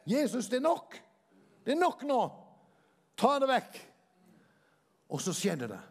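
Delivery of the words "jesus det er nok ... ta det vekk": loud, high, desperate cry of prayer; crying out to Jesus. Retold or imitated. Man praying alone in his distress, crying out loudly to Jesus. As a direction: beg loudly for it to stop. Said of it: "jeg bryr meg ikke nå om de hører meg på naborommet".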